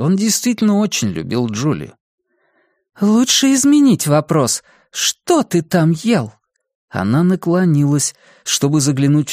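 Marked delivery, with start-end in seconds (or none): 3.0-6.3 suspicious woman. Acted. Julia questions dog.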